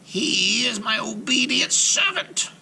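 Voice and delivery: high, slow witch voice; old witch voice. A narrator's reading. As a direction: boast like a proud old hag. Baba Yaga voiced.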